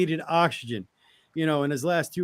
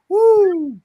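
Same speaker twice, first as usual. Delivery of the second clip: excited whoop; 9 dB louder than usual. Retold or imitated. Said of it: "I was like".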